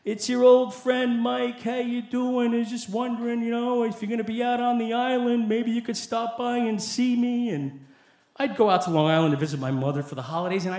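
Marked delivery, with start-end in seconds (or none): 0.0-7.5 high, monotone wheedling plea; pleading voicemail. Retold or imitated. Old friend begging a visit by voicemail, pleading. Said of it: "leave these really pleading voicemails".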